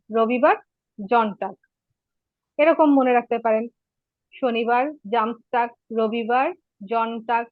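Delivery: ordinary delivery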